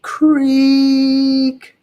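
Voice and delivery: high drawn-out door creak; loud squeaky creak; creaking sound. A narrator's reading. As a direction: creak like an old trapdoor opening.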